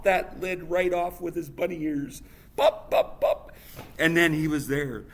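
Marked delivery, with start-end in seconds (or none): 2.6-3.5 popping noise. Acted. Lid popping off.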